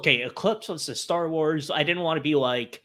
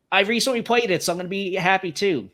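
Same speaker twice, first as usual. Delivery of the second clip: higher, louder, upbeat delivery; pleased excitement; 6 semitones higher, 4 dB louder, faster than usual. Retold or imitated. Fan reacting to the remake announcement, pleased excitement. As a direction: say it with cheerful anticipation.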